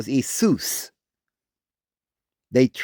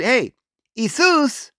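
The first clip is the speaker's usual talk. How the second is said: very high, stumbling mock calls; mocking wrong versions of his name; 10 semitones higher, slower than usual. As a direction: trip over the wrong names, high. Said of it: "They never said".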